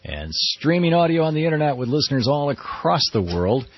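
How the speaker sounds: lightly grand radio welcome over music; old sci-fi announcer. Acted. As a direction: welcome listeners like a space-age broadcast. Show opener over music.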